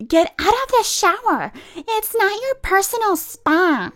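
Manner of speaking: high, loud mock yell; imitating a pushy woman barking orders; mock high yelling lady. A woman yelling at a lady in the shower, mocking.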